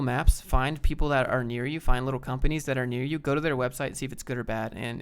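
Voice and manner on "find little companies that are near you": normal talking voice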